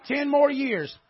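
ordinary delivery

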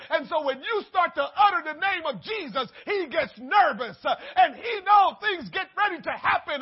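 loud, high, shouted sermon with a cry in it; fiery preaching. Real, not acted. Preacher to congregation, loud fervent preaching.

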